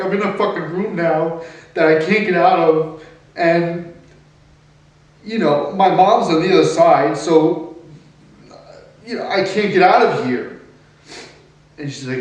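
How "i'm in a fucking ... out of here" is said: higher and louder, reliving the trapped panic; half-acted frantic protest, raised and high; retold panicked outburst. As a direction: push it out like you're locked in a room. Panicked retelling of being locked in.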